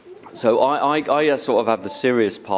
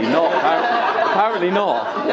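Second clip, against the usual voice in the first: laughing; 8 semitones higher, slower than usual. Real, not acted. Laughing at the crowd.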